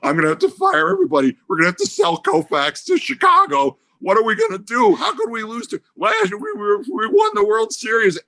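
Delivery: high, frantic voice, words tumbling out; panicking over lost money; panicked. Retold or imitated. Panicked executive pleading with a colleague, frantic and high.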